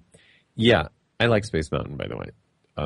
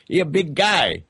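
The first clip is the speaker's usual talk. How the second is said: "He a big guy" in a higher mock accent; put-on accent; 10 semitones higher than usual. Shown as a demonstration. Host joking about the guest's size in an accent. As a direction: drop the verb, lift the pitch, joke accent. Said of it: "I don't know what accent I'm doing".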